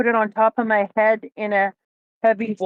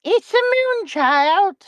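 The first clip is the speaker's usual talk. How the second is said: high, slow witchy voice; witch voice; 9 semitones higher, slower than usual. Pretend. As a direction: croon it like a witch. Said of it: "Did you hear my witch voice?".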